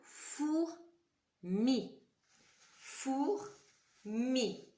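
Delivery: put-on slow, drawn-out dictation to children; patient schoolteacher dictating. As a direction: spell it out slowly for the class. Teacher dictating words slowly.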